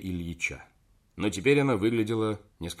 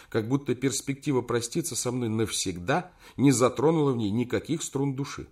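usual speaking voice

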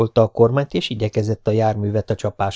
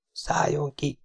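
much softer, hushed urgent whisper; 5 semitones higher, 9 dB quieter, slower than usual. A narrator's reading. Spider quietly tells Jessica to get out, hushed whisper. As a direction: whisper it so only she hears. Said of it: "súgta".

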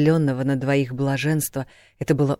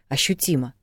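plain reading voice

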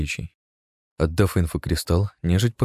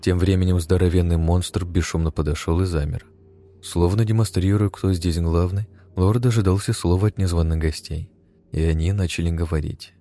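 ordinary delivery, nothing marked

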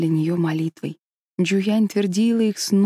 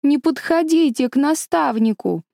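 put-on shouting girl; 8 semitones higher, 4 dB louder than usual. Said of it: "и закричала".

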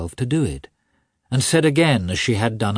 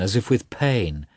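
usual speaking voice

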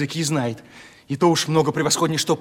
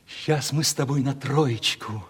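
ordinary talk, nothing marked

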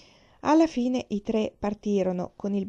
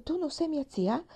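high, halting, stunned question; a girl suddenly lost and confused; the child realises the maid isn't her aunt; bewildered; 9 semitones higher than usual. Acted. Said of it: "domandò la ragazzina disorientata".

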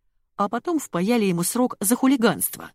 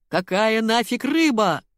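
raised, slow, indignant howl; howling outburst; 4 semitones higher, 3 dB louder, slower than usual. A narrator's reading. Colonel to the clueless secretary on the phone, howling. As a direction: wail it out, drawn out and exasperated. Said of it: "взвыл полковник".

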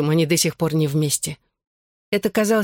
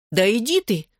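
higher-pitched "get out of here!"; astonished exclamation; 5 semitones higher than usual. A narrator's reading. Friend hears she was at his house, exclaiming. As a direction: exclaim it in quick disbelief. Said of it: "воскликнула подруга".

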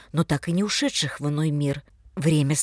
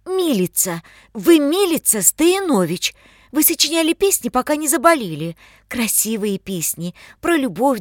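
put-on excited young man; 8 semitones higher, 6 dB louder than usual. Matvey speaks to the girl, excited.

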